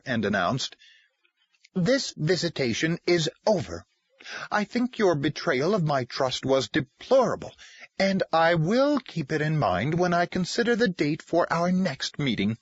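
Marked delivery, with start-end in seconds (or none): 1.8-12.6 coldly professional. Acted. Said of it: "assumed a professional demeanor".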